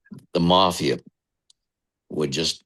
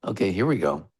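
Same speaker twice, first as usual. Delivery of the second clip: normal talking voice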